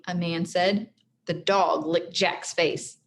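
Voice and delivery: ordinary delivery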